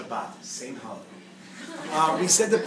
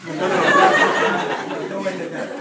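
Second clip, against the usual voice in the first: laughing protest; 2 semitones higher, 11 dB louder, much slower than usual. Real, not acted. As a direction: squeal it out through laughter. Retracting a joke punchline.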